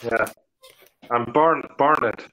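ordinary delivery

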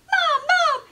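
high, loud panicked call; terrified child yelling for mom; scared scream. Acted. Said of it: "Jasper screamed".

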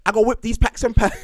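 high, loud Liverpool voice, laughing; Scouse accent through laughter. Pretend. Still doing the Scouse voice, he laughs through it. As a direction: giggle through a mock Scouse line. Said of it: "do your best Liverpudlian accent".

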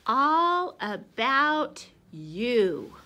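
very slow, loud "All about you"; slow title read. Shown as a demonstration. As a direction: announce the title slowly. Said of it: "reading book title".

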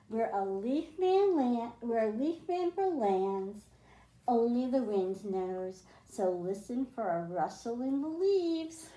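put-on reading a picture book aloud to kids; storybook reading. A narrator's reading. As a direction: read it like a bedtime story for the class. Teacher reads picture book aloud.

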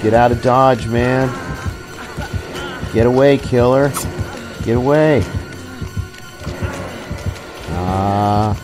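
usual speaking voice

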